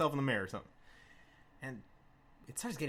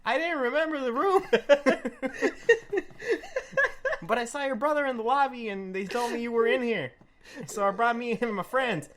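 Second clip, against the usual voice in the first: put-on higher, louder rambling drunk voice with laughter; goofy drunk; 7 semitones higher, 4 dB louder than usual. Drunk Chuck explaining.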